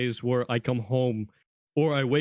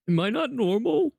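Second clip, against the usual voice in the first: higher, puzzled rising question; wondering aloud, pitched up high; self-questioning; 9 semitones higher than usual. Retold or imitated. Teen doubting themself over their own accent, high-pitched self-questioning. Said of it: "where I was just like".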